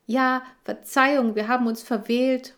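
ordinary delivery

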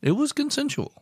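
jokey falsetto-like insistence; high-pitched mock protest. Joking protest in put-on voice.

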